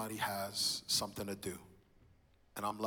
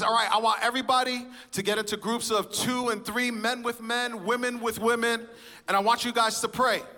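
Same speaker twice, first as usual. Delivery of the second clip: pastor's call; 11 semitones higher, 7 dB louder than usual. Retold or imitated. Pastor calls men to pray.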